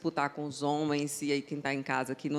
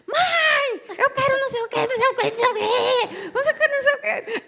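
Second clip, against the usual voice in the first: put-on very high, loud child yelling for mom; screaming little boy; 6 dB louder than usual. Narrating the son barging in on the call, a shrill child's yell. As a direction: shriek like a small kid interrupting.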